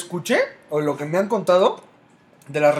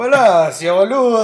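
Palacio, boludo in a louder Argentine accent; 2 semitones higher, 5 dB louder, slower than usual. Shown as a demonstration. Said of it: "¿Cómo sería en acento argentino?". (acento argentino más fuerte)

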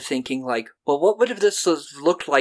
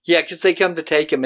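usual speaking voice

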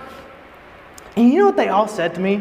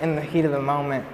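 plain reading voice

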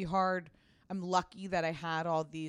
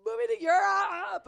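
crying and cheering, retold; 9 dB louder than usual. Retelling the news of moving to Europe, loud mock-crying cheer. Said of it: "we were crying, we're cheering".

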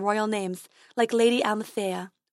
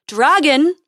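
higher, louder rising call; calling out again; 5 semitones higher, 10 dB louder than usual. Acted. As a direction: shout it into the darkness. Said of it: "he called again".

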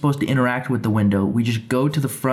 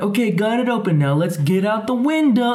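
excited; 9 semitones higher than usual. Retold or imitated. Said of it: "we're like".

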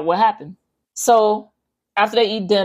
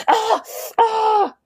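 high, crying "ah, ah" scream; mock screaming. Man dragged from the bathwater, crying high scream. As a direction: scream out in terror, much higher. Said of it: "like, just screaming".

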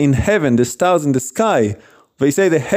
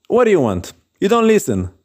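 normal talking voice

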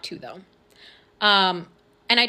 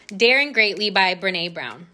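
careful naming; 3 dB louder than usual. Shown as a demonstration. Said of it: "I'm hoping that I'm saying her name correctly".

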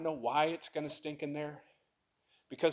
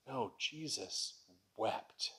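hushed emphasis; 3 semitones lower, 7 dB quieter, much slower than usual. Acted. Hushed slow emphatic line.

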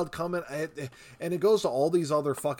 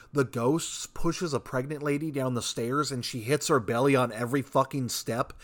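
usual speaking voice

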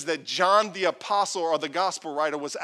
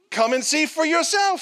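much higher, louder, bright challenge; excited Philip calling him along; eager invitation; 10 semitones higher, 6 dB louder than usual. Acted. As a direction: dare him to come look.

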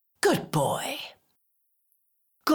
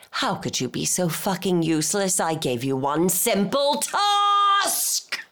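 exasperated scolding. Acted. Berating someone useless at one simple task, building exasperation.

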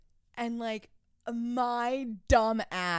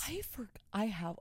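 whisper; 7 dB quieter than usual. Real, not acted. Suddenly remembers, whispered.

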